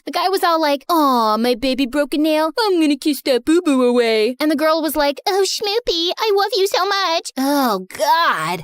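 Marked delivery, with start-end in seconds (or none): 0.9-4.3 lightly mocking cooing boyfriend voice; a hint of sugary baby talk. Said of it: "started baby talking in my face". 5.3-7.3 high, mocking girlfriend coo; mock lovey-dovey girlfriend voice, pitched up; baby talk. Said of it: "started baby talking in my face".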